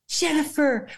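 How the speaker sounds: exclaimed name. Retold or imitated. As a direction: call her name with joy. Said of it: "with an exclamation point".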